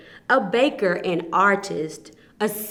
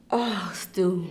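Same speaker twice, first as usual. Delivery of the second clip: whispered oh stew; awed whisper; 2 semitones lower, 7 dB quieter than usual. Acted. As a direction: breathe it in awe. Vendor whispers in awe.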